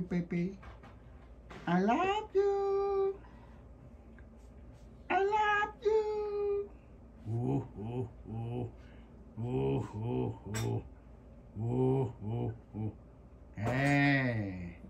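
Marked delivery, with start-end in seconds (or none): 1.7-6.7 high sing-song coo. Real, not acted. High slow coo to puppy. 7.3-12.9 low soft cooing. Real, not acted. Low cooing noises at puppy.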